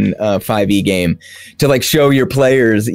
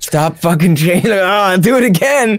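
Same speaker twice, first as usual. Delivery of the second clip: mock exasperated wizard; 9 semitones higher than usual. Wizard threatens chasing players.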